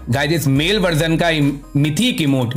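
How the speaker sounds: ordinary talk, nothing marked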